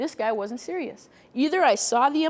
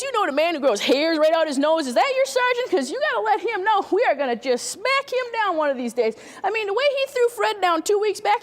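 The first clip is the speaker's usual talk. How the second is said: high, lively dialect voice, piling up asides; chatty neighbour who keeps getting sidetracked; rambling witness; 4 semitones higher than usual. Acted. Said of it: "So it might go something like this".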